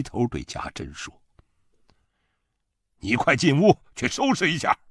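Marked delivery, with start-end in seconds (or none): 3.0-4.8 higher, forceful command; urgent stern order. A narrator's reading. Father orders his daughter to pack, stern and raised. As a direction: order your child indoors, voice raised.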